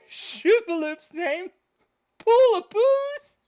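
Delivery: high, slow squeal of a silly name; squeaky silly voice. Acted. Drawing stream, squealing the silly pet name. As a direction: stretch it out, way up high.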